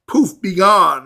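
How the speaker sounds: punchy, loud magic poof; excited. Pretend. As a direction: pop it out like a vanishing trick. Mimicking a magic vanishing act.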